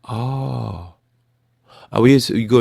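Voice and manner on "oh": gasp, then a higher drawn-out "oh"; dawning realisation. Retold or imitated. Speaker meeting such people at last, gasp of realisation. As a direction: gasp as it suddenly dawns on you. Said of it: "I'm like".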